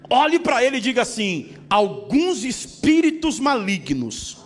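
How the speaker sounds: loud and slow, each word pounded; drawn-out pulpit declaration; slow fervent preaching. Acted. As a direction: preach it slowly and heavily. Preaching at full force, slow.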